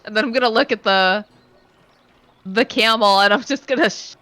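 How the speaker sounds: ordinary talk, nothing marked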